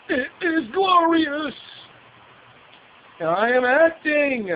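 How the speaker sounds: high, slow and loud mock villain proclamation; hammed-up Shao Kahn. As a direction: overact it like a pompous movie tyrant. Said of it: "I'm laughing at Brian Thompson's character, Shao Kahn".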